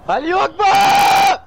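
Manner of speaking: loud, high, drawn-out battle cry; shouting. Acted. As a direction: scream it out slowly and high. Battle cry while charging in game.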